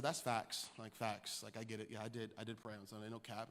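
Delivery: low, quick throwaway murmur; casual agreement. Retold or imitated. Young preacher agreeing with the hospital patient, casual and hushed. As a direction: brush it off quietly under your breath. His own casual reply, retold.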